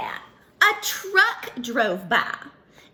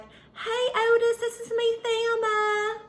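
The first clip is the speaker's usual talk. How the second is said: much higher, bright unicorn voice; dream unicorn voice; 8 semitones higher than usual. Acted. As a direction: pipe up as a proud unicorn.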